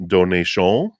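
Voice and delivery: put-on French ending on "donation", rising; playful French-accented lilt. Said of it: "That's my French accent".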